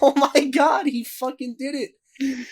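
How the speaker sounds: high, slow words through laughter; cracking up in disbelief; laughing. Real, not acted. Laughing at the story.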